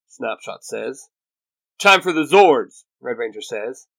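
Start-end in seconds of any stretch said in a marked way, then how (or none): 1.8-2.8 loud, raised battle call; punchy leader's command; rallying call. A narrator's reading. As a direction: call the team into action. Team leader calls for battle machines.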